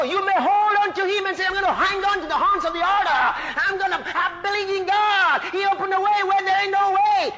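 high, loud, driving declaration; impassioned high-pitched preacher's shout; full-force preaching. Acted. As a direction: cry it out to the whole church.

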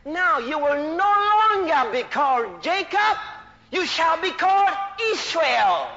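slow, drawn-out pulpit decree, swelling in volume; preached proclamation. Acted. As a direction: proclaim it slowly like a divine decree. Preacher proclaims God's words.

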